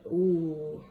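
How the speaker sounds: ordinary delivery, nothing marked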